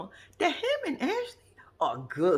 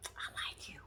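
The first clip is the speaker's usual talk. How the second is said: whispered; 15 dB quieter, slower than usual. Real, not acted. Playful aside to mama.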